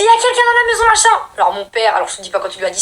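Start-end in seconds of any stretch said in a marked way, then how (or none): 0.0-1.3 high, loud frightened telling; panicked alarm. Retold or imitated. Scared kids telling dad, high frightened shout. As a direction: blurt it out in fright.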